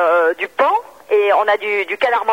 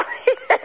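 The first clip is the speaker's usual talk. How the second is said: high giggle; 3 dB quieter than usual. Real, not acted. Bursts out at the joke.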